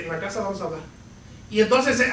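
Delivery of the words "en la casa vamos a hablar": low, much softer warning under the breath; hushed warning. Retold or imitated. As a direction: warn the child quietly so guests won't hear. Father warns son in front of guests.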